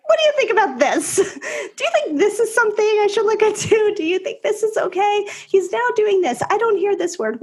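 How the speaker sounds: high, rapid-fire imitation of own texts; mock-fretful parent, pitched well up; eager questioning. Parent re-enacts her anxious lengthy texts.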